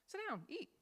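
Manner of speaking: high, soft, welcoming; gentle inviting host. Shown as a demonstration. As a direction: offer a seat kindly. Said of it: "Sounds like an invitation".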